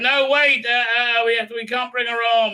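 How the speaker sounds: high, hurried protest; mock panicked objection. Boat survivors refuse to take her aboard, mock panic. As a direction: object in a hammed-up fluster.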